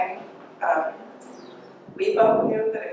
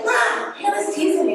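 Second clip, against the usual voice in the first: high-pitched tattletale call to Mom; tattling kid; 8 semitones higher than usual. Retold or imitated. Teased sister tattling to mom, high childish complaint. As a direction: imitate a child telling on a sibling. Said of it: "I'd be like".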